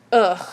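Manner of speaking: loud, short "ugh" of frustration; frustrated groan. Real, not acted. Fed up with pain from the weight, frustrated groan.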